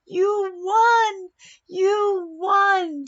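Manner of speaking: loud, high cheering; joyful shout. Acted. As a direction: shout it like overjoyed teammates.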